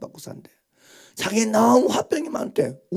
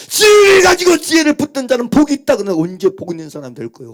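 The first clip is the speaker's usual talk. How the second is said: fast, loud, tearful sermon cry; crying preaching shout; 5 semitones higher, 9 dB louder, faster than usual. Real, not acted. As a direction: plead with them, voice breaking.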